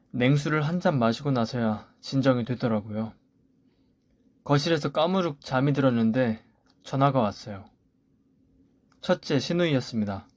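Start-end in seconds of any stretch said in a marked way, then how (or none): none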